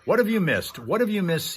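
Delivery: ordinary talk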